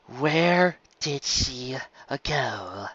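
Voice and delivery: slow menacing demand. Acted. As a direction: drag it out as a menacing question. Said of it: "Bon Bon demanded".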